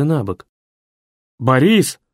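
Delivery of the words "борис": a loud call, rising at the end. A narrator's reading. Paralysed man calls loudly for his secretary. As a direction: shout for the secretary from bed. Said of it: "закричал я".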